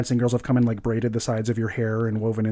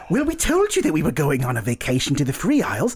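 a posh, much higher sing-song tourist voice, slowing on the last words; prim holiday tourist; 8 semitones higher than usual. Acted. Couple greeting the party on the beach, in gushing high tones. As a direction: play a gushing couple, delighted at the chance meeting. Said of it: "It's like".

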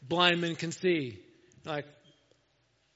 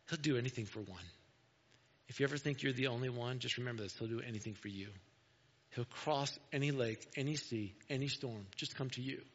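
softer, lower, comforting preacher; hushed reassurance; 6 semitones lower, 10 dB quieter than usual. Real, not acted. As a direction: bring it down low and gentle to reassure. Preacher reassures listeners after dramatic story.

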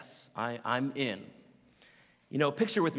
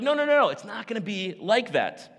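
high, loud hurried angel denial; imitating the angel; 6 semitones higher, 7 dB louder than usual. Angel hastily correcting Mary, higher and louder. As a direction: brush off her worry quickly. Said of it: "And the angel's like".

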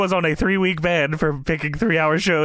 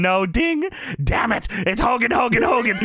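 high, worked-up retelling; mock frantic Vince; 7 semitones higher than usual. Host acting out Vince yelling about Hogan, high. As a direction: ham up the boss losing it.

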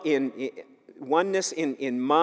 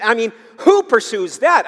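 higher, louder mock disbelief; incredulous reaction; 5 semitones higher, 5 dB louder than usual. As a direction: challenge it as if it were absurd. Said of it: "that kind of reaction".

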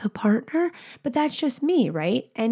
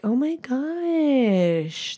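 very drawn-out, higher "oh my gosh"; acted heart-melting reaction; touched gushing; 4 semitones higher, much slower than usual. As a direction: stretch it out, gushing at sweet news. Her touched reaction to feedback.

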